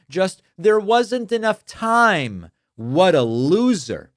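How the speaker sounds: slow, high, drawn-out mockery read from a comment; mocking scorn. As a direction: stretch it out loud and high with derision. Reading aloud a scornful listener comment.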